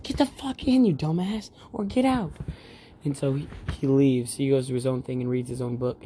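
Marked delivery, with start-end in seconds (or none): 0.0-2.3 imitating a squeaky high yell; shrill-pitched mock shout at the guys in the tent; high yelled quote. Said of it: "He's like".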